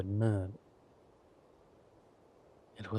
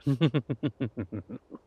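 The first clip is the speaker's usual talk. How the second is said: drawn-out gloating laugh; villain chuckle; 3 semitones higher, 4 dB louder than usual. Acted. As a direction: chuckle with smug satisfaction. Villain laughing with his consort.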